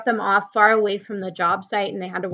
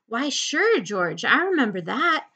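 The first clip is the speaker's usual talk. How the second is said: eager, with high lifts in pitch; happily remembering, a touch excited; delighted; 7 semitones higher than usual. A narrator's reading. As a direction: answer with a pleased smile. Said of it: "broke into a delighted smile".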